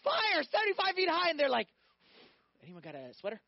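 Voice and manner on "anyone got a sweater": low, soft, casual question; quiet, nonchalant aside after the shouting; deadpan understatement. Acted. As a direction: toss it off as if mildly chilly. Men feel cool amid the flames.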